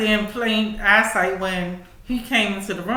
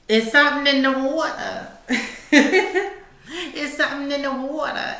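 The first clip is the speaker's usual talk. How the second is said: laughing; 5 semitones higher than usual. Real, not acted. Laughing at the videos.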